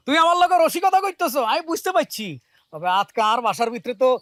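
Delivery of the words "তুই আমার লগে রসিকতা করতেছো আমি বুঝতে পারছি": high, loud, amused outburst; gleeful realization. Acted. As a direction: pipe up high, convinced she is teasing. Takes her threat as teasing. (উঁচু গলায় খুশিতে গদগদ)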